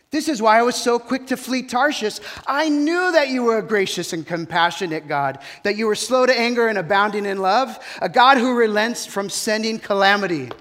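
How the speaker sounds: exasperated Jonah calling out, accusing; angry prophet. Acted. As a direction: Jonah protesting his anger at the Lord. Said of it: "calls out to him in his anger and says".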